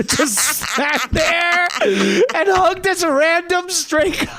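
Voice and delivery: high, slow, laugh-crying voice; laughing through tears. Real, not acted. Comic describes hugging the stranger, laugh-crying high. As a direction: squeeze it out while laughing.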